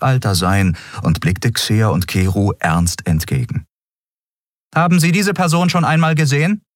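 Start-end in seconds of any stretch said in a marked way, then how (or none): 4.7-6.6 much higher, fast official question; guard questioning travellers with a picture; guard's brisk question. A narrator's reading. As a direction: rattle it off like an officer. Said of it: "guard questions".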